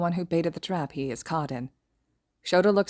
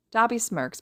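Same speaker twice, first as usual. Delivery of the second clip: ordinary delivery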